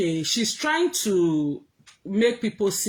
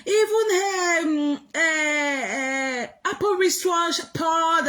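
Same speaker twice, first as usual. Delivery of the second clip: high, whiny mock crying voice; sarcastic sing-song whimper mocking a bragging friend; mocking Lolo; 11 semitones higher than usual. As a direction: wail it out like a spoiled brag, pitched way up. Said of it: "Lolo said".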